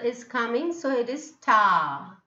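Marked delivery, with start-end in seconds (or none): 1.3-2.2 "star" ending on a long ah; silent R. Shown as a demonstration. Teacher explaining the unspoken letter, silent R. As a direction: say "star" as "staah", without the r. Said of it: "No pronunciation of r".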